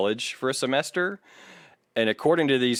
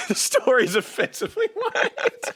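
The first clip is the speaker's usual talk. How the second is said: repeating it through laughter; cracking up mid-sentence; echoing the joke with a laugh. Real, not acted. Repeating line while laughing.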